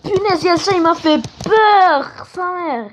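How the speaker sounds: high, shaken startled cry; startled by the cat. Real, not acted. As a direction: jump at a sudden fright.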